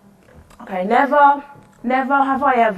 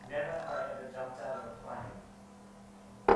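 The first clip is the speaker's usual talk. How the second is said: other player; 11 semitones lower, 20 dB quieter than usual. Pretend. Other player's turn voiced.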